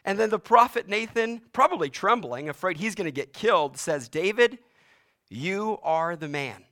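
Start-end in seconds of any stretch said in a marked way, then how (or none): 4.1-6.6 grave, slow you are the man; solemn accusation. Acted. Prophet Nathan confronting David, grave and slow. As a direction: pronounce judgment on the king. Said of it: "And not in the way we like to hear".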